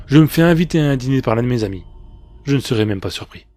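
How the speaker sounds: usual speaking voice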